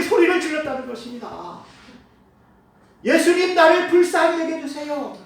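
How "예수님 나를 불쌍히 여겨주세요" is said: higher, louder pleading cry for mercy; desperate loud cry. Acted. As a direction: cry out to Jesus with all your heart. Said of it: "간절히 소리를 지르면서". (간절하게 크게 부르짖는 소리)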